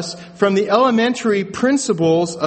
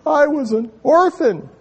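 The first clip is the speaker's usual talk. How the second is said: high, drawn-out fake sobbing; mock tearful breakdown; 7 semitones higher, slower than usual. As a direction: wail it in hammed-up, wounded self-pity. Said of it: "Bill would break down in tears".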